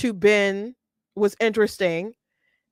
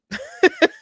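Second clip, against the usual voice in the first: short high laugh bursting out; high burst of laughter. Real, not acted. Laughing at the clip's line.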